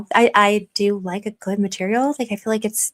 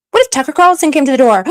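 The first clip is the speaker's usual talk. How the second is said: loud, high thrilled what-if; picture the dream guest at the door; excited; 4 semitones higher, 8 dB louder than usual. Real, not acted. Host to viewers, a loud thrilled what-if. Excited fan imagining visit.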